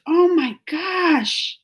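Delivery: awestruck. Real, not acted. Amazed Doughboy got a second chance at life, awestruck. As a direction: stretch it out slowly in wonder.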